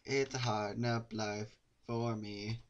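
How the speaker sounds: slow, flat deadpan; deadpan repeat. Pretend. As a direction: drag it out deadpan.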